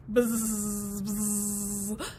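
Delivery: low buzzing lawnmower noise. Acted. Mower approaching the grass, low buzzing. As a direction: buzz like a mower.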